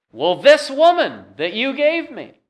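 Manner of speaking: high, complaining blame voice; whiny Adam. Retold or imitated. Adam blaming Eve before God, high and whiny. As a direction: pass the blame with a high whine. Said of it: "And Adam says".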